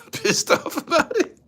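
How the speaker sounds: high, loud laughing squeal; laughing mock squeal.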